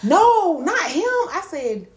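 high, loud exclaimed denial; emphatic correction. Real, not acted. Woman correcting her friend's misunderstanding, high emphatic denial.

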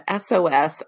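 usual speaking voice

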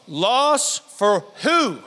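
slow question. Acted.

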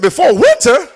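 shocked, high-pitched "Before winter?"; incredulous echo. Retold or imitated. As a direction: throw "Before winter?" back in disbelief. Incredulous echo of prophet's deadline.

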